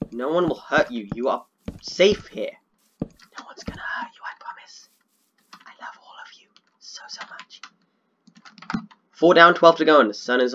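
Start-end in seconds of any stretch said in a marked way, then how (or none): 3.0-7.6 soft tender whisper. Real, not acted. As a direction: whisper comfort to the dogs. Soothing dogs, scared of creepers.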